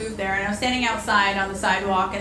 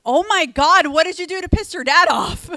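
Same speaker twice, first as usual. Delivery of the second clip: higher, louder mock alarm; shocked reply; 7 semitones higher, 6 dB louder than usual. Sheltered teen reacting to a classmate's confession, in loud mock shock. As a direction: gasp it out, truly alarmed for her. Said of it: "I was like".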